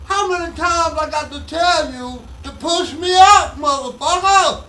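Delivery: high-pitched, loud, raspy-edged mock yell; Richard Pryor yelling. Comedian imitating his idol yelling from the back, loud and high. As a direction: bellow it from the back of the room, half-laughing at the crude demand. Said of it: "And I was surely".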